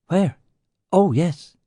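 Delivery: surprised. Acted. Colleague startled, looks round.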